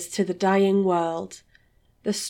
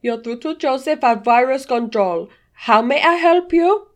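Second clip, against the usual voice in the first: put-on loud, rising Indian accent call-centre voice; 4 semitones higher, 7 dB louder than usual. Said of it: "An Indian voice answers".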